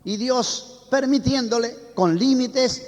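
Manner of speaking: normal talking voice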